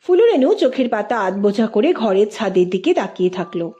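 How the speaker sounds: ordinary delivery, nothing marked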